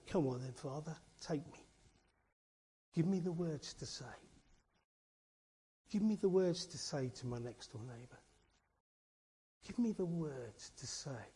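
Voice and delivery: a quiet, sincere plea for words; hushed prayer. Pretend. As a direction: pray it softly, as if to God alone. Prayer asking God for words.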